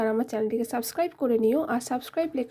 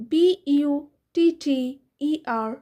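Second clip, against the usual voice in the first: ordinary talk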